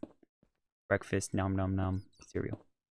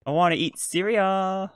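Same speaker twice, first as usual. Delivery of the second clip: high, loud drag queen sass; sassy drag queen; 9 semitones higher, 6 dB louder than usual. Pretend. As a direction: belt it like a diva. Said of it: "Sound like a drag queen there".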